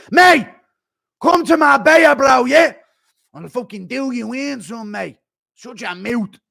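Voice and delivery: put-on brash Irish fighter shouting at an old man; McGregor impression. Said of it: "Can I get a McGregor impression".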